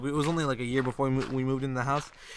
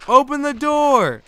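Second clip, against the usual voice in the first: high, loud, very drawn-out cry; long desperate yell; 11 semitones higher, 9 dB louder, much slower than usual. Retold or imitated. Retelling being locked out as a child, long desperate yell. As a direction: stretch each word into a desperate yell. Retells yelling louder to get in.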